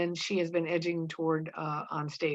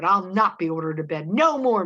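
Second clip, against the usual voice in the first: foot-stamping defiance; 2 semitones higher, 7 dB louder than usual. A narrator's reading. Mary Warren refusing Proctor's order to bed, indignant. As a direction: refuse the order, punching the last words. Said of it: "with a stamp of her foot".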